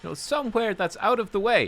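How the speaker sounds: high, lightly put-on Russian accent; a quick joking Russian-accented NPC impression; mock Russian accent. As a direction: imitate the quest giver in a loose Russian accent. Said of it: "she's kind of weirdly Russian".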